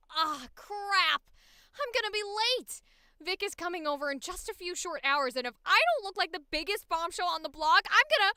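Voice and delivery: put-on frazzled teen girl ranting; exasperated cartoon teenager, rising pitch.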